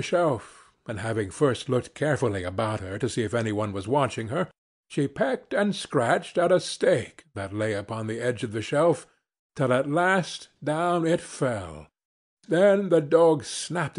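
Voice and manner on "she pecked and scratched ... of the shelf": ordinary delivery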